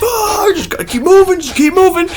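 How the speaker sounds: squeaky cartoon pep talk to himself; SpongeBob voice. Hiker urging himself on, high cartoon voice. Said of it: "Kinda felt like SpongeBob".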